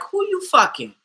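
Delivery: high, loud accusing demand; indignant retort. Retold or imitated. Boyfriend's retort while pushing her off.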